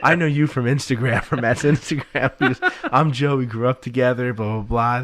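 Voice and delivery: loud, high, amused retelling; forward introduction. Retold or imitated. Friend explaining himself to his pal's wife, loud and forward. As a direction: barrel through a chummy introduction with amusement.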